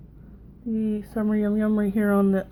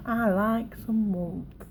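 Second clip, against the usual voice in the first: put-on slow, drawn-out Southern American accent; 4 dB quieter, much slower than usual. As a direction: lay on a lazy Southern twang. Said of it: "Southern accent thing, right?".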